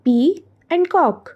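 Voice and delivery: पी, pause, then cock, each slow and distinct; syllables named separately. Shown as a demonstration. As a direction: set पी and cock apart as two beats. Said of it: "टू syllable". (पी और cock को अलग-अलग, धीरे बोलते हुए)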